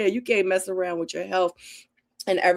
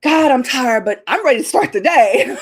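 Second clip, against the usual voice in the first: higher and louder, tired yet pumped; groggy but game; 6 semitones higher, 8 dB louder than usual. Retold or imitated. Waking at seven A M, mock-cheerful weary declaration. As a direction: laugh through a mock pep talk to yourself. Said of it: "we'll wake up at seven A M and be like".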